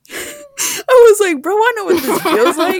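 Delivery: laughing through the words, loud and high. Retold or imitated. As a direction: crack up while bragging. Retelling triumph after being punched.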